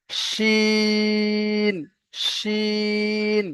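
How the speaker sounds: শিন held long with a heavy hushing sh; drawn-out shin. Shown as a demonstration. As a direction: stretch শিন, pressing it. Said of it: "এই উচ্চারণটা এখানে হবে". (চেপে মোটা টানে শিন)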